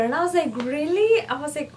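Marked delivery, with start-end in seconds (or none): 0.6-1.2 surprised really. Retold or imitated. Surprised he works there.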